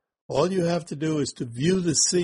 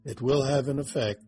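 guided meditation. Acted. Guiding through the scene.

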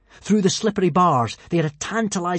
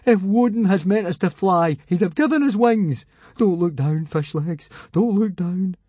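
put-on nervous boy voice; 4 semitones higher than usual. A narrator's reading. Fishlegs voiced, scared climbing.

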